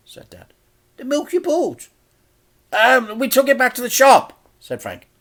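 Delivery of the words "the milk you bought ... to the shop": put-on much higher, louder old-lady voice; a fussy auntie greeting; Auntie Flip, cheery. A narrator's reading. Flip then Frank high.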